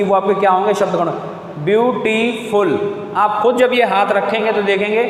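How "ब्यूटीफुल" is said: Beautiful slow, split evenly into view, टी, फुल; syllable-by-syllable. Shown as a demonstration. Teacher showing pupils the hand test, syllable-by-syllable. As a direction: give each syllable of beautiful its own push. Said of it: "ताकत महसूस होगी".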